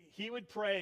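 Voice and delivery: ordinary talk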